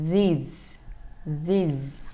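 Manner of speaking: "these" with buzzing th and a voiced z ending; "these" slow, tongue between teeth, final z buzzed. Shown as a demonstration. Teacher models "these" for parents. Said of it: "Chúng ta đọc là".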